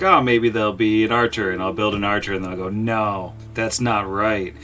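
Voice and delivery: ordinary talk, nothing marked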